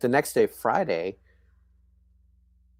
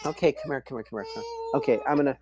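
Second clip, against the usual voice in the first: softer, hushing a fussing infant; 5 dB quieter, faster than usual. Real, not acted. Mother soothing her fussing baby during the interview, hushing softly.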